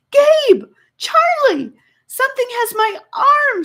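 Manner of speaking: high, loud frightened yelling for help; panicked yelp. Acted. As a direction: scream for the boys to save her. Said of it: "she yelped".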